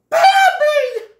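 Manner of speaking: loud, piercing high shriek; joyful shriek. Acted. Friends see Bambi come out, loud shriek. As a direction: shriek the name at top pitch. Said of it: "Feline and Thumper shriek".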